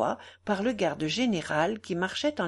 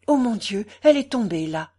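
louder exclamation of dismay; acted, raised-voice alarm; alarmed discovery; 3 semitones higher, 8 dB louder than usual. A narrator's reading. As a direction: cry out on finding the trace. Michaud discovers where girl fell.

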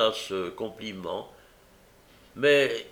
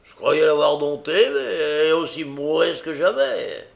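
loud, higher, exasperated outburst; angry complaint; 5 semitones higher, 7 dB louder than usual. Acted. Brigand complains Nancy is untamed, angry. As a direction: vent forcefully. Sykes rages about Nancy.

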